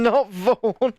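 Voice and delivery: normal talking voice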